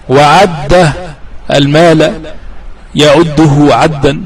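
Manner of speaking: usual speaking voice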